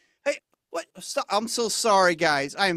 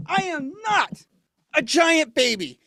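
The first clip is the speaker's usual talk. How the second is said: put-on louder, higher, like an offended toddler; indignant protest; 7 semitones higher, 4 dB louder than usual. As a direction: protest like a sulking giant baby. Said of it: "Sounds like someone needs a nap".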